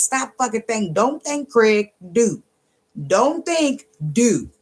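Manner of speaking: high, punchy order; exasperated scolding. Acted. Reader scolding someone who overthinks, exasperated.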